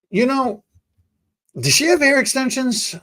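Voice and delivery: lightly voiced curious buddy; quoting buddy. Buddy's quote, high-pitched.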